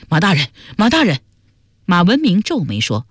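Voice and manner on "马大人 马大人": quick, urgent hail in character; calling from afar. A narrator's reading. Constable running up to Lord Ma, calling out urgently. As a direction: call out to him as if from a distance. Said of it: "远远叫道".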